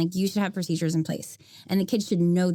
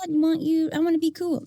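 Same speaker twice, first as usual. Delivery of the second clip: high-pitched teacher wanting to be liked; timid teacher voice; 9 semitones higher than usual. Acted. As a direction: pitch it high, eager to please. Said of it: "timid teacher voice that wants to be liked".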